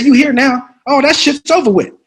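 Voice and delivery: loud, high, forceful pep talk; Sam Jackson impression. Veteran actor coaching the nervous newcomer, forceful impression. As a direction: drill it into the rookie. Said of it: "He said".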